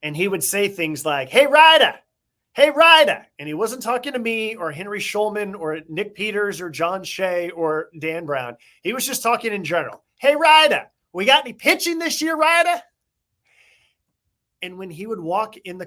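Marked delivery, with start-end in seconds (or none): 1.3-3.2 high-pitched loud hollered greeting; affectionate high-voiced impersonation; Willie Mays. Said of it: "Willie had this high-pitched voice". 10.2-12.8 very high, hollered question; affectionate high-pitched impersonation; Willie Mays. Retold or imitated. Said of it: "Willie had this high-pitched voice".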